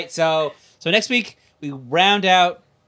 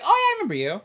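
high, chirpy pretend recognition; high mock greeting; 14 semitones higher, much faster than usual. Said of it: "I'm like".

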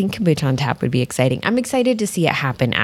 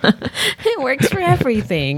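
laughing; 10 semitones higher, slower than usual. Real, not acted. Laughing along about naming.

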